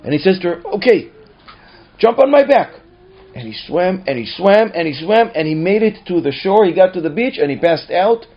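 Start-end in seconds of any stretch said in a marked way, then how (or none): 0.8-2.7 loud, high, sudden resolve; rallying shout. Acted. Father rallies, calls to daughter. 3.3-8.3 higher, louder, driving storytelling; urgent narration. A narrator's reading. Narrator builds the long swim.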